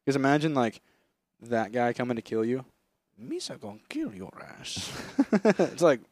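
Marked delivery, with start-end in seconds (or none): 3.2-4.9 a softer, lightly done Jar Jar voice; mock Jar Jar voice. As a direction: threaten in a goofy alien voice. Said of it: "Why do you talk like that".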